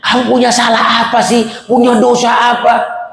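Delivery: high, pleading question, slowing at the end; distressed questioning. Acted. Teacher questioning doomed pupil, high and distressed. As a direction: press the question in anguish, mildly acted.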